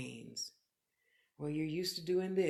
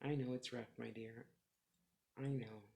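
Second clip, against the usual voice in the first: much softer, lower, soothing; tender comfort; 6 semitones lower, 7 dB quieter than usual. Real, not acted. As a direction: console her gently. Comforting a viewer.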